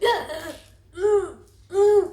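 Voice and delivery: high little moans of pain, mimicked; groggy mock yelps at each contraction; waking scream, imitated. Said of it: "to scream".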